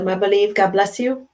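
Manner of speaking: ordinary talk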